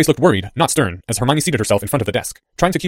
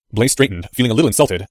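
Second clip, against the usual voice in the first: ordinary delivery, nothing marked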